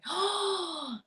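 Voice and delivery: high gasp of mock horror; mock shocked gasp. Mimicking a prude shocked by cussing, mock shocked gasp.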